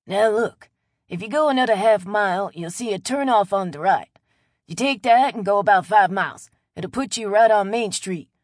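put-on friendly old local, loud and chatty; a neighborly Louisiana old-timer talking up a stranger; old Cajun man Chet. Chet gives driving directions.

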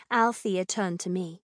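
usual speaking voice

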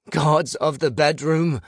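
high, loud invocation; calling out. A narrator's reading. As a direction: call out over a roaring quake. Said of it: "I called over the quake".

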